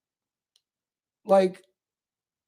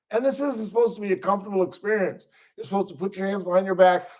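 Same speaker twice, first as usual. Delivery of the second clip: normal talking voice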